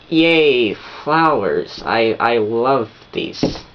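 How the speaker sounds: half-hearted yay that sags and slows; mock tired enthusiasm. Girlfriend given flowers too often, half-hearted mock delight. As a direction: fake delight, dragging out the last words. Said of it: "don't do this all the time".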